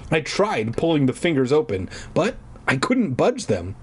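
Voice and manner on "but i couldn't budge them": ordinary delivery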